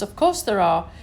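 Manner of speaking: ordinary delivery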